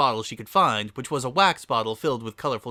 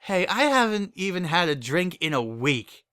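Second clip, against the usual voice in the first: protesting; 7 semitones higher than usual. Acted. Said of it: "protested Berry Punch".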